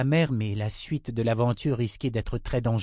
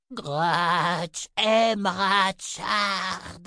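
very slow, higher creature voice, aime drawn out clearly; creature repeating; 9 semitones higher, much slower than usual. A narrator's reading. The garne repeating itself to the girl, slow clear creature voice. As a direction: repeat it slowly so every word is understood. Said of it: "répéta l'étrange créature".

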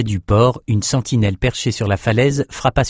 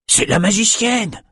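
high, drawn-out cry of recognition; excited exclamation; 12 semitones higher than usual. A narrator's reading. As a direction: cry the news out to the crew. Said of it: "s'écria l'un d'eux". (exclamation aiguë)

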